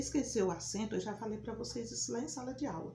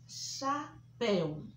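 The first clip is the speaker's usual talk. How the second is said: chapéu drawn out slowly, open é; slow demo; much slower than usual. Shown as a demonstration. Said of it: "som aberto". (chapéu bem devagar, é aberto)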